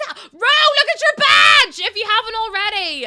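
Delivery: sudden high, very loud yell; excited scream. Real, not acted. Streamer screaming at Ro about the badge, excited scream. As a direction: scream it out, stretching the words. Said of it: "I didn't mean to scream at you".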